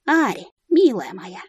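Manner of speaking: медленное ласковое обращение; заговори мягко, исподволь располагая к себе; вкрадчиво. A narrator's reading. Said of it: "вкратчиво спросила я девушку". (вкрадчиво и ласково)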